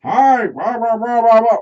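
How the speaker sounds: very high, loud mocking phone chatter; loud phone talker. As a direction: blare it like a rude caller. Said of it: "you know what I do".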